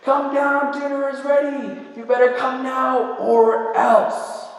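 put-on high sing-song child's call up the stairs; little girl calling. Daughter calling her sisters down, high sing-song child voice. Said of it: "she tries one more time".

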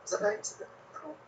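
high, breathy skeptical squeak; mock surprised whisper; mock incredulous. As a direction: pitch it up in disbelief. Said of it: "is like".

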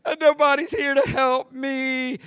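put-on poor-me moaning; mock whining. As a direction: wail feebly like a helpless victim. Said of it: "lays on the ground and says".

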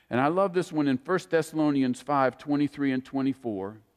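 ordinary talk

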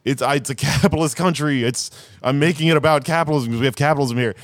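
high, laughing impression of a creator explaining; a lightly voiced, high director; mock creator quote. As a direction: pitch it up like an exasperated creator spelling it out. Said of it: "The creators of these things are saying".

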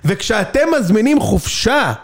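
slow, stretched-out exposé punchline; mock economic TV report. Parodying TV reports on holiday profits, mock-dramatic reporter. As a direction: drag out each phrase like a scandalized reporter. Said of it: "תמיד יש כתבה כלכלית".

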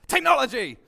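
sudden loud shouted word. Retold or imitated. Comedian springing on a passerby with a sudden loud shout. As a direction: bellow it at a passerby to startle them. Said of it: "a stranger to shout technology".

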